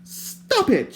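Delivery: high, loud sharp cry. A narrator's reading. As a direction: cry out to stop him. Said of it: "she cried".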